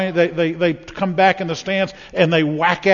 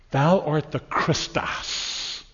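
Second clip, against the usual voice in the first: solemn slow declaration; 5 dB quieter, much slower than usual. Acted. Peter's confession quoted.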